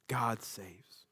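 reverent hush; whisper. Real, not acted. Pastor whispering the meaning of Jesus' name. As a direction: breathe it softly.

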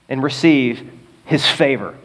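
normal talking voice

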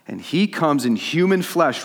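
slow, high, emphatic proclamation; impassioned preaching. Real, not acted. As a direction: proclaim it with force. Preaches climax of the parable.

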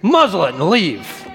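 slow, deliberate order; commanding. Retold or imitated. Jesus to the demon, commanding it to leave. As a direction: lay down a measured command. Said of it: "That's what Jesus says to the demon".

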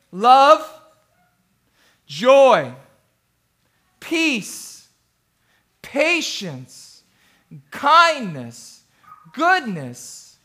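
one word at a time, long pauses; slow reading. Shown as a demonstration. Pastor reads the fruit of the Spirit to friends, slowly. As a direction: read the list slowly, let each word stand alone. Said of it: "I'll read it slow".

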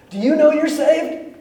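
high, loud earnest question; eager question. Retold or imitated. Sister turning to her brother, high and eager. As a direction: ask it brightly, leaning in. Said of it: "and she goes".

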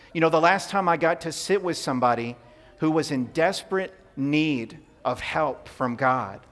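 normal talking voice

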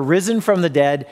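ordinary talk

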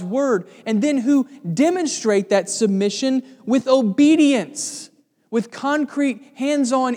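preaching at full force. Acted. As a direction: drive the sermon home with rising fervour. Preaching builds to a climax.

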